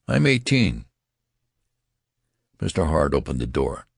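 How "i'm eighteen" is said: high-pitched, insistent outburst; indignant protest. A narrator's reading. Benjamin protesting his age to the registrar, indignantly. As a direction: protest it, pitch jumping right up.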